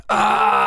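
short loud scream of pain. Acted. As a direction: cry out as the bullet hits. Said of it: "заорал дважды раненый". (короткий крик боли)

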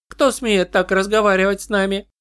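high, eerie hive chorus; eldritch horror. Acted. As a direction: demand like many voices at once. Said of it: "вопросили голоса, звучащие как один".

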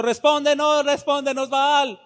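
high frantic pleading call; frantic prophets. Retold or imitated. Preacher voicing jumping prophets, high frantic pleading. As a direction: cry out high to a silent god.

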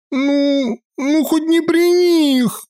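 on the verge of tears. A narrator's reading. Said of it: "вот-вот разревётся".

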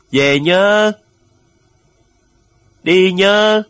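high, loud drawn-out call; farewell shout. A narrator's reading. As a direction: shout it out to the leaving ship. Farewell calls from the shore.

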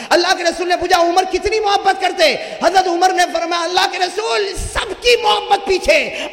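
impassioned preacher's climb; fiery sermon. Acted. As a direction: drive it home to the crowd. Story told at full force.

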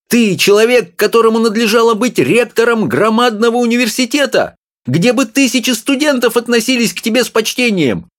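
high, fussy little woman scolding; wife's voice. A narrator's reading. Small wife scolding her husband, high and fussy. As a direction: nag him in a high voice.

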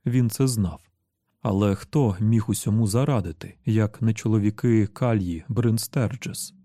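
ordinary delivery

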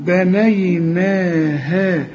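بنيناها chanted slowly, each alif drawn long; slow recitation. Shown as a demonstration. Teacher shows correct reading, slow chant. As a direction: draw out the vowels of بنيناها. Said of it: "تقول".

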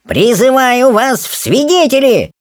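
high, grandly drawn-out appeal; theatrical exclamation. Acted. Rolla appeals to the gathered kobolds, high theatrical cry. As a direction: proclaim it to the whole crowd. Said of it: "воскликнул он".

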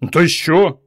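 loud, high snap; angry outburst. Acted. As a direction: bang the table and demand. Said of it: "вдарив кулаком по столу".

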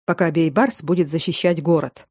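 usual speaking voice

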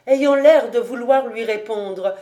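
ordinary delivery

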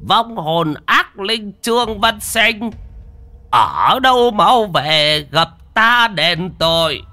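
high, slow, drawn-out summoning chant; ringing ritual command in a shaman's high voice; loud incantation. A narrator's reading. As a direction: summon the spirit, stretching every word high. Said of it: "lão mới dừng lại đọc lớn".

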